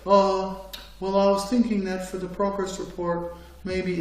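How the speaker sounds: a soft, hesitant, timid mumble; meek mumble. Retold or imitated. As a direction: shrink into the chair and mumble. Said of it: "just wanna sit in their little chair".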